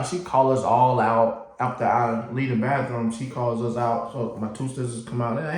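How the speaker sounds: ordinary talk, nothing marked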